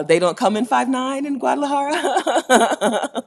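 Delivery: high, loud, laughing drawn-out delivery; real laughter bubbling through the words. Real, not acted. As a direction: crack up while saying it slowly. Laughing at own height joke.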